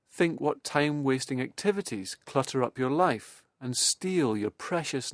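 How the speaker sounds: ordinary talk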